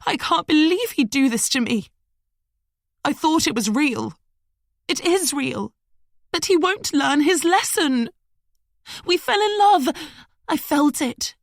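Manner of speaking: high, wailing lament; a jilted woman's high-pitched despair; distraught woman. A narrator's reading. Madeline wailing at the tree's base over Liam.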